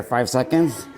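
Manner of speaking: ordinary delivery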